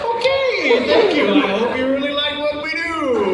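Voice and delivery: very high, loud laughing reply; stunned politeness. Retold or imitated. Stunned after a child swears at him, high laughing thanks. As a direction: squeak out a flustered thank-you. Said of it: "I'm like".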